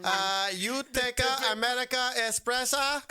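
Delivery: slow, high-pitched mock Italian accent; fake Italian. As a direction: imitate someone faking Italian in a singsong drawl. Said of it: "started speaking Italian".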